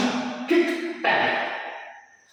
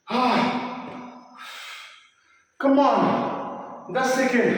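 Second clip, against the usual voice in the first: energetic coach shout; urging on; 6 semitones higher, slower than usual. Real, not acted. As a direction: push them through the last seconds.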